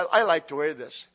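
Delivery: usual speaking voice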